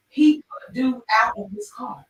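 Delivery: normal talking voice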